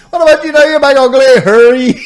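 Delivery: high, very loud hammed-up holler; heckling passers-by. People hailing the podcaster, loud jeering greeting. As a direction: yell the teasing greeting at full volume. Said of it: "People just be like".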